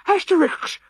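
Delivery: high breathless gasp of a name; panicked high gasp; gasped cry. A narrator's reading. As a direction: blurt it out gasping for air. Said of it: "he gasped".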